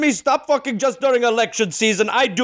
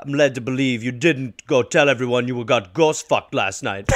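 usual speaking voice

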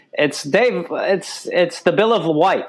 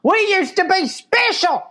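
high-pitched comic alarm; mock panic; 9 semitones higher than usual. Acting out people who lament lost special status, high-pitched mock panic. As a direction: wail it high in exaggerated dismay. Acted mock panic over things falling apart.

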